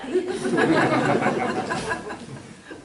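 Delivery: big burst of laughter; loud cackle. Acted. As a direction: throw out a hearty cackle. Bramble laughs loudly.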